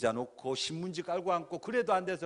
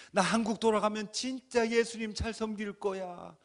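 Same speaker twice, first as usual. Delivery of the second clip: loud, higher fervent vow; crying out a vow; 8 semitones higher, 7 dB louder than usual. Retold or imitated. A man vowing to God, shouting. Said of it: "그렇게 외치고".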